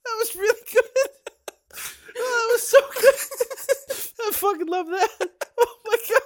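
high squealing laughter, barely getting words out; helpless tearful laughter, squeaking through the words; crying with laughter. Real, not acted. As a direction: laugh till the tears come. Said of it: "I cried".

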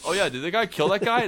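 high, loud, breezy question; cheerfully prying interviewer, pitched high; mock investigator. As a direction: toss it off like a nosy outsider.

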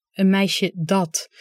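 一字一顿、慢慢纠正"dat"; slow correction. Shown as a demonstration. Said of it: "Dat," zegt mijn zus".

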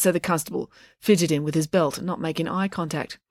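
plain reading voice

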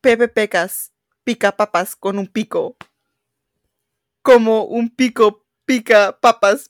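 slow, deliberate Spanish twister; slow careful twister. Shown as a demonstration. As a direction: take each syllable slowly. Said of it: "tongue twister attempt".

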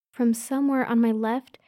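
usual speaking voice

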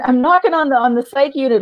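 ordinary delivery

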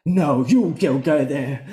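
lightly voiced preacher cadence; a touch of pastor yelling at her choir; imitating Mary's preacher-like lilt; Mary's pastor inflection. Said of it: "an inflection that Mary does".

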